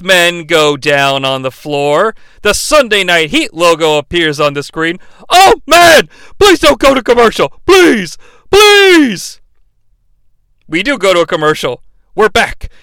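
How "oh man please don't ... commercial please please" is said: loud, much higher pleading; mock begging. Mock Shane begging not to cut to commercial. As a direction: wail please like a desperate fan. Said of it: "voicing Shane begging".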